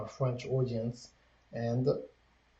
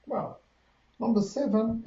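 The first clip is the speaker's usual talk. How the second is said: excited; 11 semitones higher, 6 dB louder than usual. Real, not acted. Sudden excited jump in pitch.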